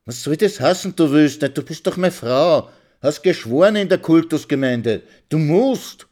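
high, loud insistence; husband insisting she must obey; angry demand. Acted. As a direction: lay down the law, shouting.

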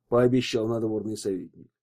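usual speaking voice

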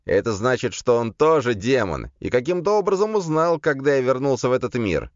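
high-pitched demon explaining; demon Legion. A narrator's reading. Demon Legion revealing a rival demon to his master, emphatic explaining.

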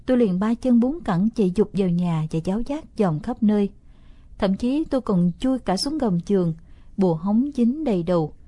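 plain reading voice

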